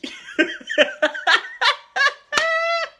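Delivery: high screaming laugh; shrieking laugh. Real, not acted. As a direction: burst into a loud shriek of laughter. Burst of laughter.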